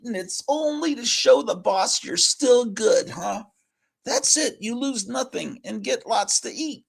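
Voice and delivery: high, musing mouse voice; tempted mouse. Acted. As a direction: think over the cheese offer, high and eager. Mouse weighs the deal.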